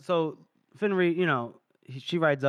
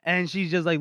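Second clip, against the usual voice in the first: normal talking voice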